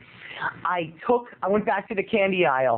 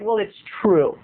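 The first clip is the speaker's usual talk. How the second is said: ordinary talk, nothing marked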